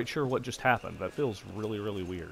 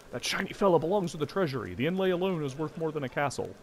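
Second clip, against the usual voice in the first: higher, firm officer; stern commander; 6 semitones higher than usual. Acted. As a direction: put them in their place. Commander answers.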